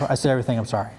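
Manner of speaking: ordinary talk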